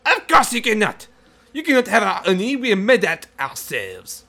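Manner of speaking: high, loud haughty bee swarm; sneering French bee refusal; French taunter accent. Acted.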